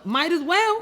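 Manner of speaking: "might as well" squeaked high and loud with a rise; high exasperated squeal. Real, not acted. High squeaky repeat of phrase.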